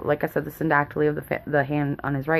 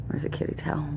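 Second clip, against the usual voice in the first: whisper to cat; 8 dB quieter than usual. Real, not acted. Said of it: "The cat's right here next to me".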